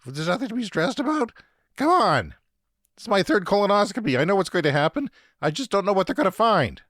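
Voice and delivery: high, strained mock panic; half-joking snap. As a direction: whine it out, half in jest. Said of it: "half joking".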